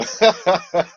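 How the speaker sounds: short burst of high, loud laughter; real cackle of appreciation; amused laugh. Real, not acted. Host enjoying Gary's joke, high loud laugh.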